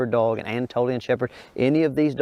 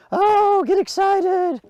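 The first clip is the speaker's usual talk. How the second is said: very high, louder hype-up; excited squeal; 4 dB louder, much slower than usual. Real, not acted. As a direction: rev the dog up with a squeal. Hyping up the dog.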